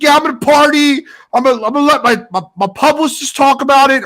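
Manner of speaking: high, loud dopey imitation; mock a dazed, rambling fighter; mocking Izzy. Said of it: "He sounded like a complete moron".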